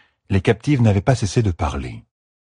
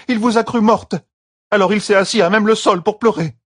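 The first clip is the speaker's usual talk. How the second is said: higher, louder earnest report; Aravine the servant; 3 dB louder than usual. A narrator's reading. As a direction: explain respectfully to her lady. Aravine explaining. (servante respectueuse qui rapporte)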